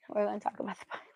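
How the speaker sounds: fast, laughing whisper. Real, not acted. Mom whispering with a laugh as she steers to the Bible. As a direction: hurry it under your breath. Laughing aside to her baby.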